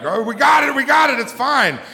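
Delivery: high, loud call of security guards rushing in. Retold or imitated. Guards bounding out of the woods, high loud shout.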